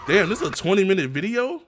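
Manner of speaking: loud, high disbelief; shocked. Real, not acted. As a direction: blurt it out in disbelief. Shocked at video length.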